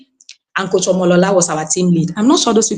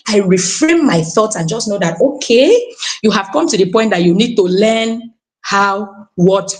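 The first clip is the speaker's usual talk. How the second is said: normal talking voice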